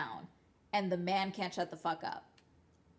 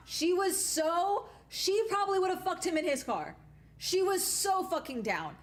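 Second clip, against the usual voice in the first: exasperated emphasis; 10 semitones higher, 3 dB louder than usual. Real, not acted. Telling listeners how eager the friend was, high and emphatic. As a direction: stretch every word up high in disbelief. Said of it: "emphatic high retelling".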